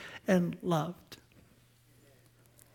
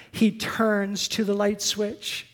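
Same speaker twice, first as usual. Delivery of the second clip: usual speaking voice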